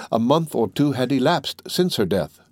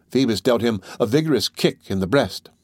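usual speaking voice

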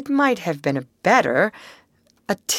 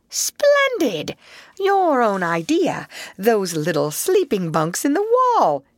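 high, brisk, hearty voice; cheerful bustle; 5 semitones higher than usual. Acted. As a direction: bustle about and cheer him up. Rat bustling, cheering up Mole.

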